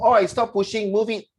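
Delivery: loud, high Singlish shove. Shown as a demonstration. Teacher warns class this Singlish is not proper English, loud and high. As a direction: snap it like a pushy commuter. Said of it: "If you put".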